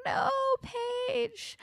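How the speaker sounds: very high, crying whine; anguished plea. Retold or imitated. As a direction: wail it in despair. Speaker's own pleading reaction.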